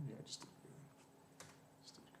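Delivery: barely voiced, muttering under his breath; whispered mutter. Real, not acted. Coder whispering to himself after spotting his mistake, muttered. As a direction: whisper it to yourself as you spot the bug.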